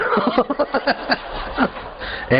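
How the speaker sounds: very high, breathy cackling; irrepressible laughter. Shown as a demonstration. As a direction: burst out laughing despite the warning. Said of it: "मत हंसिए". (रोके न रुकने वाली हँसी)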